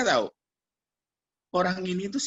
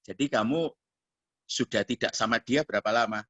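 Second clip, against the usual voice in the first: low, settled questioning; tone suddenly lowered; 5 semitones lower than usual. Retold or imitated. Pastor asking the girl about her boyfriend, lowered tone. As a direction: drop the voice and ask gently. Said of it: "nada saya langsung turun".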